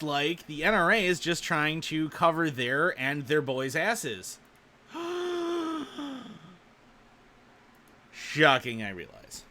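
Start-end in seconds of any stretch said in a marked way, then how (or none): 4.9-6.3 long, exaggerated gasp; hammed-up gasp; mock shocked gasp. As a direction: gasp in feigned shock, drawn out. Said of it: "gonna make myself dizzy doing that".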